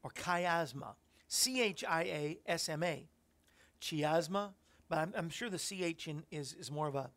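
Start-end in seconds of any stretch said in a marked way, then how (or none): 0.1-0.9 "chiasma" opening with a kai sound; alternative pronunciation. Shown as a demonstration. Preacher offering another way to say it, alternative pronunciation. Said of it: "I hope I'm saying it right". 3.8-4.5 "chiasma" with ch as in cheese; spelling pronunciation. Shown as a demonstration. Preacher testing the word as spelt, spelling pronunciation. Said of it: "a k sound".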